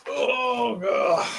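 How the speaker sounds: strained groan pulling boots; effortful groan. Real, not acted. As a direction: groan as you tug. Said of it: "Old man noises".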